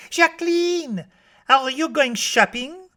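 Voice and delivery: put-on very high little-girl voice; Josette. A narrator's reading. Small girl asking the maid about shopping, high child voice. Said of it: "asks Josette of the maid".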